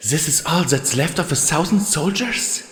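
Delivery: high, stunned disbelief; shocked. Acted. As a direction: gasp the question out in shock. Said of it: "echoed her shocked tone".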